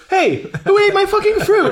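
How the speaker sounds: high, loud outraged yell through a laugh; yelling across the room. Retold or imitated. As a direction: holler at whoever ate it. Acted painter finds fruit gone.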